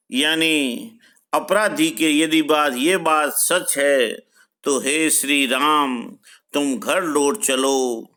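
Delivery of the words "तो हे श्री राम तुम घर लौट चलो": ordinary talk, nothing marked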